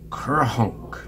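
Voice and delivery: imitated honk of a blown nose; nose-blowing honk. A narrator's reading. Mouse blows his nose after Bear explains, honking. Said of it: "Mouse sniffed and blew his nose".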